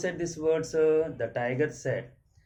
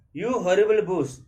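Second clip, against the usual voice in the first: loud, raised angry read-aloud; angry scream; 6 semitones higher, 8 dB louder than usual. A narrator's reading. Voice abusing the tiger in a story, loud angry read-aloud. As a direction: read the insult out high and loud. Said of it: "a voice screamed angrily".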